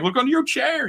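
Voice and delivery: high-pitched game giveaway announcement; lightly voiced host pitched well above normal; Oprah. Talk show host handing gifts to the audience, lightly imitated. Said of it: "I'd be like Oprah".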